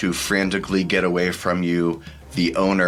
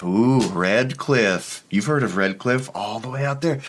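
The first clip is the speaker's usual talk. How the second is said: boastful merchant; 4 semitones higher than usual. Acted. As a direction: lure the buyer with exotic names. Merchant boasting of far origin.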